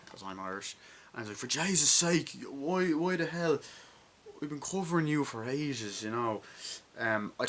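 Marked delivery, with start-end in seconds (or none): 1.4-6.0 raised, higher voice in mock outrage; mock exasperation. Retelling the Irish bar scene, high mock exasperation. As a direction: imitate someone loudly fed up.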